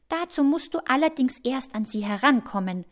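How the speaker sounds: lightly voiced word of caution; pointing out a snag. A narrator's reading. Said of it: "gibt die Ameise zu bedenken".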